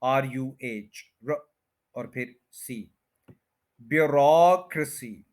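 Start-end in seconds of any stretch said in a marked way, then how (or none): 3.8-5.3 bureaucracy as byu-ROCK-ruh-si; bureaucracy with ROCK loud and a short ruh; stress on rock. Shown as a demonstration. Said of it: "रॉक पे आपने पूरा stress लाना है".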